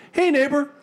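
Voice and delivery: high, sing-song fake friendly greeting; cheery neighbor voice. Imitating the hoarder neighbor's sudden sweet greeting. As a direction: chirp it brightly, falsely friendly. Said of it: "but then he's like".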